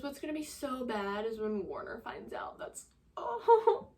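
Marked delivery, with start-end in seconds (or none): 2.6-3.9 much higher, teary breaking voice; real tearful breaking voice; crying. Real, not acted. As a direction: cry through the words, half laughing. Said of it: "it's hurting me thinking about it".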